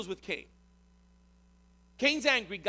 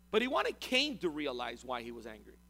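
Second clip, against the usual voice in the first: ordinary talk, nothing marked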